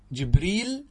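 جبريل said as jibril, ending clearly on l. Shown as a demonstration. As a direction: pronounce جبريل with a final l: jibril. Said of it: "باللام". (جبريل تُنطق باللام في آخرها)

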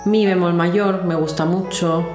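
normal talking voice

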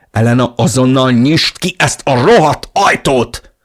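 loud, high, furious demand; angry yell. A narrator's reading. Narrator demands the locked door be opened, yelling. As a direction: shout it hard at a shut door.

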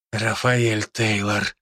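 slow, toneless zombie reply; emotionless. A narrator's reading. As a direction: say the name with no feeling at all. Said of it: "безэмоционально ответил зомби".

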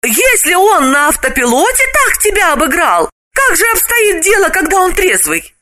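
high amazed female voice; astonished. Acted. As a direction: gasp in disbelief, high. Galina continues amazed.

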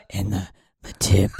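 man voice. Pretend. Man voice breaking into laughter.

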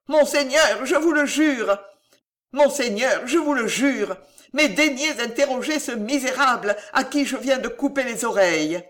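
high, insistent swearing of innocence; acted frantic plea; desperate pleading. A narrator's reading. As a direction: beg the master to believe you. Servant swears innocence, begging master.

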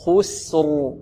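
خسر with heavy kha, light sin, heavy ra; drawn-out teaching model of خسر; careful recitation. Shown as a demonstration. Tajweed teacher modelling heavy and light letters, careful recitation. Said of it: "نفخم الخاء، نرقق السين".

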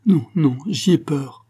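high, anxious refusal; frightened, urgent little outburst; fearful protest. A narrator's reading. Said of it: "murmura-t-elle en baissant la tête".

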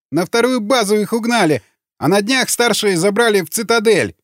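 high, pleading prisoner voice; frightened confession. A narrator's reading. Kicked prisoner answers interrogator, frightened. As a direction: give it all up in a high, scared voice.

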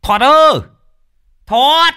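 put-on high drunken shout from outside; calling out. Drunk Hiệp shouting for Thoạt at the door, loud slurred call. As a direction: bellow the name across the yard.

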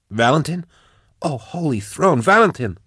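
high, breathless disbelief at seeing him; a rising gasp of relief, then his name again; astonished relief. Acted. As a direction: cry out his name in stunned hope. Max sees rescuer from her cage.

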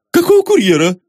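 high-pitched horrified yelp; aghast, voice leaping up. A narrator's reading. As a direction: squeak the question in alarm. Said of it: "ужаснулся Изнурёнков".

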